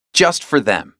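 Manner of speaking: ordinary delivery, nothing marked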